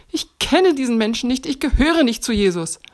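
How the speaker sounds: lauter, höherer wütender Ruf; wütend gerufen. A narrator's reading. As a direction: schrei es wütend heraus, um dich zu retten. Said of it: "rief Petrus richtig wütend". (wütend und laut gerufen)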